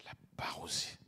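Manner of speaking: hushed reverent whisper. Real, not acted. Preacher naming the Parousia to the church, reverent whisper.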